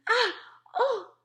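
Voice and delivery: short, high, very loud cries; roar. A narrator's reading. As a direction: roar out in high-pitched panic. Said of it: "roared the flesh lump eater".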